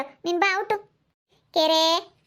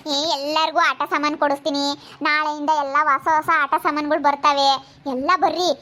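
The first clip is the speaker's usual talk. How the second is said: ordinary talk, nothing marked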